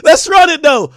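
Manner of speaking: loud, high, excited yelling to run it back; hyped shouting. Real, not acted. Hyped to replay the diss track.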